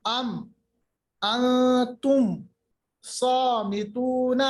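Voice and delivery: أَنْتُمْ with held nun and clean final mim; slow recital, long vowels in صَامِتُونَ; clear mim. Shown as a demonstration. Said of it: "بالکل بھی دیری نہیں کرنی". (میم کو ظاہر کر کے تلاوت)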